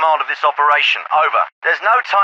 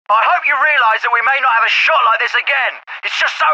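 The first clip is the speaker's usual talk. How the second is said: higher-pitched, exasperated scolding; exasperated rebuke; 7 semitones higher than usual. Acted. Officer to corporal over a missed shot, exasperated. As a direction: berate them, voice raised in pitch until words fail you.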